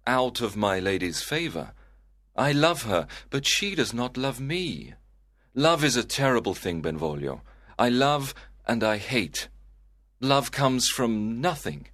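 lovesick sighing. Acted. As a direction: lament unrequited love to your cousin. Lovesick Romeo laments.

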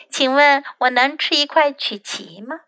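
plain reading voice

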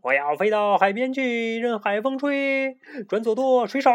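very high, crazed sailor's cry; wild shouting. A narrator's reading. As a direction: shout like a mad captain. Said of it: "大声地喊叫". (疯狂地大声喊叫)

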